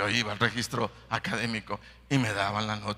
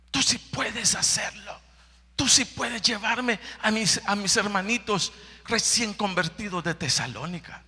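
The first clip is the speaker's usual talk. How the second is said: pleading prayer; 8 semitones higher than usual. Acted. Paul's prayer rising to pleading.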